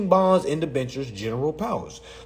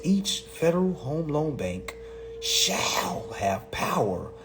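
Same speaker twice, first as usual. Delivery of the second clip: slow, softer, drawn-out reading; 6 dB quieter, slower than usual. Shown as a demonstration. Reader rereading the bank statute to listeners, slowly. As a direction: read it out slowly, stretching each phrase. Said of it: "Let me read it slower".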